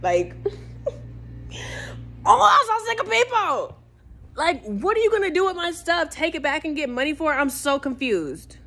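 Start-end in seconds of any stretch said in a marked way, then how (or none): 0.4-2.2 frustrated laugh. Real, not acted. 2.2-7.4 fed up with people, let it out; exasperated outburst. Real, not acted. Venting to viewers about thieves, high exasperated outburst.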